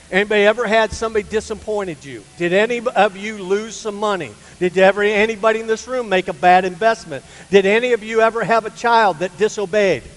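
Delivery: loud, rising preacher's questions; preaching challenge. Acted. Preacher pressing the church on their troubles, loud and rising. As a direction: press the congregation with each question.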